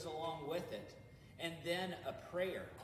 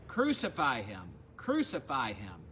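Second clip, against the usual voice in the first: raised, insistent repeated cry; the crowd presses Pilate with a louder shouted demand; crowd shouting louder; 3 semitones lower, 9 dB louder than usual. Acted. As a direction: shout it back at Pilate twice. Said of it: "they shouted all the more".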